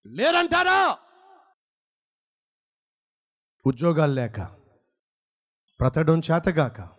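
rising, shouted peaks, then low falls; fiery preaching. Acted. Preacher challenges the congregation in fiery preaching.

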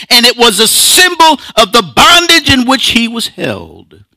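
high-pitched, emphatic pulpit delivery; impassioned preaching. Acted. Preacher likening slave name to sin's bondage, impassioned. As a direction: drive it home from the pulpit, high.

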